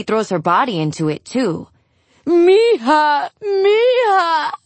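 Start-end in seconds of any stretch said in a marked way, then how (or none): 2.3-4.5 high, loud cry of a grieving mother; wailing scream. A narrator's reading. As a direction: wail it out, pitch climbing far up. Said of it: "she screams".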